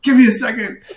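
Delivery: loud, high, hammed-up whine; retold flustered outburst; upset protest. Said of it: "I got upset".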